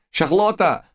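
very high frantic French call; panicked shout. A narrator's reading. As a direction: shriek the name in panic. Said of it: "крича на ходу".

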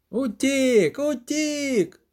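high-pitched, drawn cry of alarm; shouting priest. A narrator's reading. Priest announcing the Djinn has escaped, shouted. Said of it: "закричав жрець Креп".

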